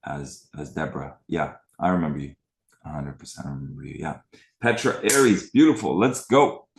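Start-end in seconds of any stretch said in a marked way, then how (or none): 4.6-6.7 hyped, higher and louder shout-out; excited welcome. Real, not acted. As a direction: pump up the viewer by name.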